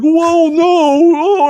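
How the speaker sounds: high, loud mock shriek of surprise; startled yelp. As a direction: yelp like a boy caught off guard. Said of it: "He's like".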